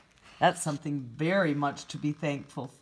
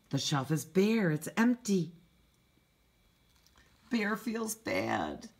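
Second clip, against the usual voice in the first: ordinary delivery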